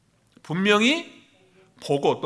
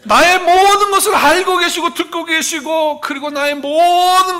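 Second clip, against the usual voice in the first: loud, high preaching at full force; fiery preaching; 8 semitones higher, 8 dB louder than usual. Real, not acted. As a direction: drive it home to the congregation. Preaching climax.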